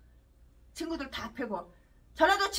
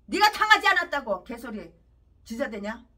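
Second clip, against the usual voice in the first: ordinary talk, nothing marked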